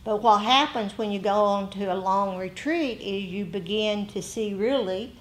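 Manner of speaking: ordinary talk, nothing marked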